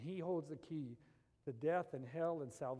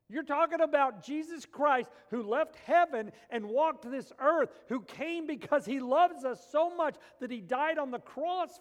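loud, high preacher's challenge; full-force preaching; 9 semitones higher, 12 dB louder than usual. Acted. Preacher challenging the doubters, loud and high. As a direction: build the challenge hard.

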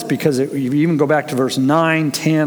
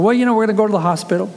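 higher, rushed, evasive mother voice; Heather; 7 semitones higher, much faster than usual. Retold or imitated. Pregnant mother answering her little girl, evasive. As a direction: dodge it quickly in a raised pitch.

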